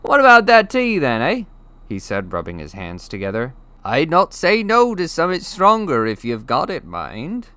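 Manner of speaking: high, loud, hearty giant voice; jolly giant. Acted. The giant asks for tea and something stronger, jolly. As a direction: rub your hands and ask for a drink.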